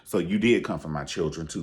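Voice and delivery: ordinary talk, nothing marked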